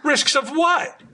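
shrill falsetto squeal; high squeaky voice. Acted.

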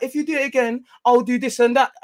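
high-pitched imitated warning; mock chef threat. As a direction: act out a heated threat from the chef. Voices the chef's threat to him.

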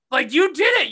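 loud and high, stress on did; heated repeat; angry outburst. Real, not acted. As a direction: hammer the accusation home. Repeats the accusation.

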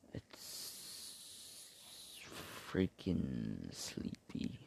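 hushed sleepy whisper. Real, not acted. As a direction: drop to a whisper. Sudden whisper after loud lines.

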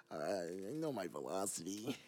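low, soft put-on mimicry; quietly mocking kid imitation; mocking co-host as kid. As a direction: imitate your co-host to make fun of him.

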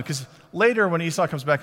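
ordinary talk

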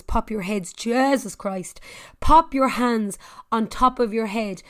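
ordinary talk, nothing marked

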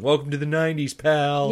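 high, loud action-hero one-liner; yelling. Acted. Acting her yelling down to a police car, loud. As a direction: call it out like a movie catchphrase.

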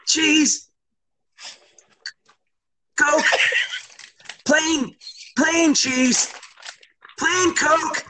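high, halting one-word blurts; panicked dad imitation, very high-pitched; nervous dad ordering. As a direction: blurt each word high, with long gaps. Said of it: "this is what he would say".

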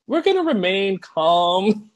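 high, drawn-out mock coach; all over the place. Coach to his team mid-speech, high mock composure. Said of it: "every time he talks, it just goes, like, all over the place".

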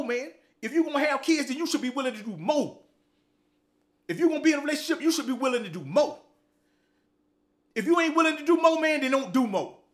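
rising to a loud, high preacher's cry; preaching. Acted. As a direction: hammer it home like a street sermon.

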